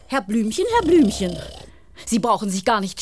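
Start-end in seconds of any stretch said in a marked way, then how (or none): 0.7-1.4 calling out. Acted. Nurse calling sleeping patient.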